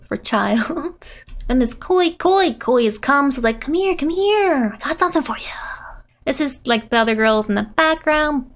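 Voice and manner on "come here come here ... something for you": beckoning. Acted. Voices character beckoning Tora.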